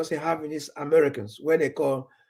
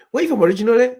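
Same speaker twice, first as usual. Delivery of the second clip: higher, louder imitated questioner; mock probing question; 7 semitones higher, 8 dB louder, much faster than usual. Golfer asking where he is really from, probing. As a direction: imitate a nosy golfer, pitched up.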